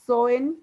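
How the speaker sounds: SOEN, la O y la E fundidas en una sílaba; una sola sílaba. Shown as a demonstration. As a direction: di SOEN de un golpe, recalcado. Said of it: "sería una sola sílaba". (unido como una sola sílaba)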